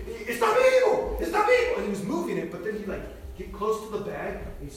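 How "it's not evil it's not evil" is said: very high imitated yell. Preacher shaking the bag before the crowd, yelling high. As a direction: stretch it out as a high, alarmed cry. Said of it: "he's, he's yelling".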